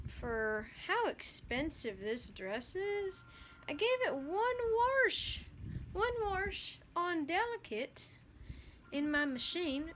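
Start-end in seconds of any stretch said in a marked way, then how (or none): 3.7-9.9 indignant. Real, not acted. Indignant about one wash.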